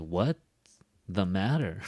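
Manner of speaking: rising "What", a pause, then falling "the matter"; cut-up word groups. Shown as a demonstration. Teacher showing how to cut up the phrase, broken apart. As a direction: break it after "What" with a clear gap. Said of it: "cut it up".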